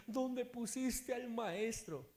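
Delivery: high, slow tearful wail; acted weeping plea; imitated crying. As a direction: draw the question out as if sobbing. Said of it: "Estaba llorando".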